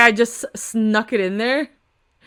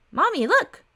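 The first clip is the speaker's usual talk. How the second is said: put-on much higher, eager little kid; excited child; 10 semitones higher than usual. Little girl pointing at the man from TV, high and eager. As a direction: squeal it like a small child tugging mom.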